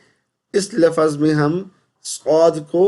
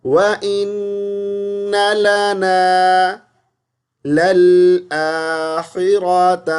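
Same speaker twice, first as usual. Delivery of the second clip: slow, higher melodic tajweed; correct recitation; 4 semitones higher, 3 dB louder, much slower than usual. Shown as a demonstration. Teacher models the correct recitation slowly.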